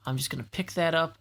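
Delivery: ordinary talk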